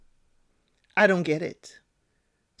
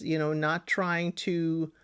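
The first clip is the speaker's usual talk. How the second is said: usual speaking voice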